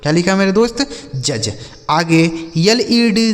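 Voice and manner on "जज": "जज" with j at both ends, no d; low, soft "जज" with silent d; model pronunciation. Shown as a demonstration. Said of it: "यह लिखा गया आपका जज". ("जज", ड साइलेंट)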